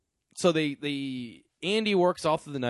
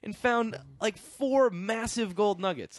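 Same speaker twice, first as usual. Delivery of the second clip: incredulous rant; 9 semitones higher than usual. Real, not acted.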